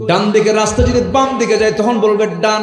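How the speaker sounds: high, loud sermon chant; full-force preaching. Acted. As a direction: preach it out high and ringing to a crowd.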